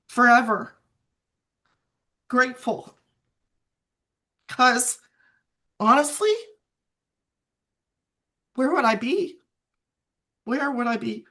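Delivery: fighting back tears through the words; crying. Real, not acted. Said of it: "I'm sorry for crying".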